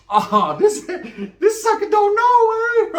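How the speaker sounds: high, slow mocking taunt with a laugh; mocking homeboy voice. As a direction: sneer at the sucker who doesn't know. Guy mocks him in story.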